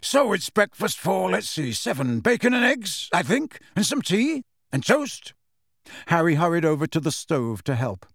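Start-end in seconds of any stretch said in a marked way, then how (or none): 0.0-5.3 cheerful. Acted. Sirius cheerfully counting breakfasts.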